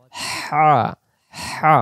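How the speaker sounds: হা pressed from the middle of the throat; pressed throat haa. Shown as a demonstration. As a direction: squeeze হা from mid-throat. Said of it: "হলকের মধ্য হতে আওয়াজ চাপ দিয়ে".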